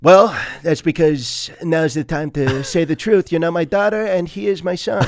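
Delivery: gruff overprotective dad. Pretend. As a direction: announce the truth grandly as the dad. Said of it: "you and this guy sound eerily similar".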